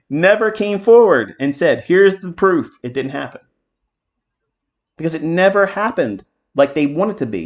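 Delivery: high, loud, indignant insistence; defiant vindication. Real, not acted. Defiant rant against his doubters.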